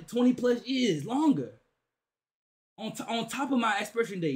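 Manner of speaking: ordinary delivery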